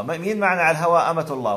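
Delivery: announcing a caller with exaggerated energy; mock on-air host. Host acting out taking a live call. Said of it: "سمي حالك أمة الله".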